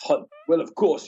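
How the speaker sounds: usual speaking voice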